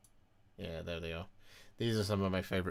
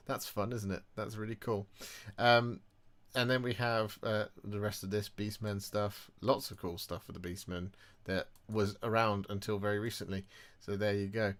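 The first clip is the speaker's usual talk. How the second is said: ordinary talk, nothing marked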